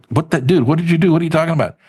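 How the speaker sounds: high-pitched, incredulous retold reaction; baffled disbelief. Replaying his reply to the excited friend, baffled. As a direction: demand an explanation, acting out bewilderment.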